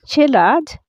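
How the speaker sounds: usual speaking voice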